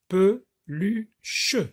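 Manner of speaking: each syllable split off with a short pause; pe, lu, che tapped out one beat at a time. Shown as a demonstration. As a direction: chop the word into its syllables for pupils. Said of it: "la méthode de base consiste à découper".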